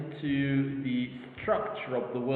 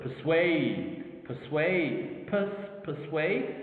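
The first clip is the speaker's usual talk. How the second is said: "persuade" with a long falling SUADE; quick light "per", then lean on SUADE; stress on second syllable; much slower than usual. Shown as a demonstration. Teacher saying let's look at this word, "persuade" stressed on SUADE. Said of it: "is in the second syllable".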